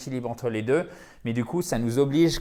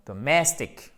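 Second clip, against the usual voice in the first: DOMESTIC as duh-MESS-tik; second-syllable stress; 2 semitones higher, slower than usual. Shown as a demonstration. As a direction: punch MESS in "domestic", weaken the first vowel. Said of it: "Accent tonique ici".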